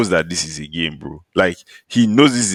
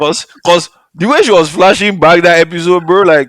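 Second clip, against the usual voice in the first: excited; 11 semitones higher, 7 dB louder than usual. Real, not acted. Animated theory about the flashbacks.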